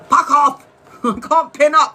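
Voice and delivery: real angry curse at the screen; real frustrated outburst. Real, not acted. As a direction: snap it out, fed up with the app. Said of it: "It's pissing me off".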